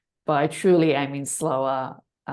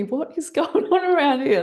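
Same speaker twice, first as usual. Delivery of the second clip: high, loud, incredulous laugh through the words; laughing amazement; 10 semitones higher, 6 dB louder than usual. Retold or imitated. Retelling her reaction to strange sensations, laughing amazement. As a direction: exclaim it in amused disbelief.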